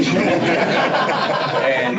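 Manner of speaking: laugh. Real, not acted. Coach recalls championship prediction.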